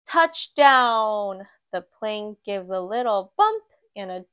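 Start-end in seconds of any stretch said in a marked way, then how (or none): none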